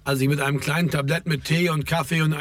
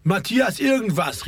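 higher, drawn-out interjection; lightly projected call; 7 semitones higher, slower than usual. A narrator's reading. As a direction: throw it in from across the room, pitched up. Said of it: "rief Raimund".

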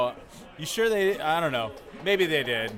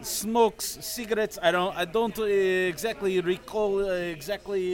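slow, higher, halting Russian accent; 4 semitones higher, slower than usual. Shown as a demonstration. Performer struggles to recall film for castmates, slow Russian accent. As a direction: stall for memory in a put-on Russian accent. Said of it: "cannot do a Russian accent".

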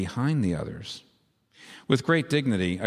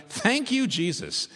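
high-pitched grateful exclamation; jubilant mock shouting; 11 semitones higher, slower than usual. A narrator's reading. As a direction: exult as if shouting thanks to Jesus.

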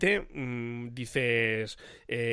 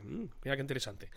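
usual speaking voice

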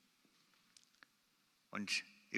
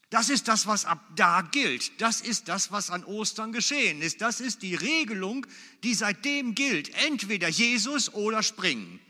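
loud, emphatic pulpit proclamation; a preacher raising his voice to drive the point in; preaching at full force; 6 semitones higher, 9 dB louder than usual. Acted. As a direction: hammer each phrase home to the congregation. Opening punchline, preached loud.

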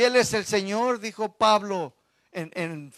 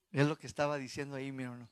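ordinary talk, nothing marked

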